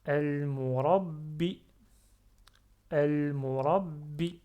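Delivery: "Al-murabbi" fort et lent, b redoublé; b retenu. Shown as a demonstration. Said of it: "je retiens le ba". (« Al-murabbi » avec shadda appuyée)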